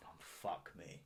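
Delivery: breathy under-breath curse; a whispered groan at the mess; whispered despair. Retold or imitated. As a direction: mutter it in dismay. Plant splats on floor.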